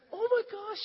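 high-pitched mock astonishment; very high, amazed retold reaction; astonished. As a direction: imitate a woman's stunned gasp of recognition. Acting astonished former classmate.

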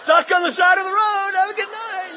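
loud, high comic call; hammed-up shouted farewell; mock cheery send-off. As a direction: holler it gleefully as if driving past. Mock send-off to stranded driver.

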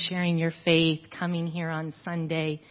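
usual speaking voice

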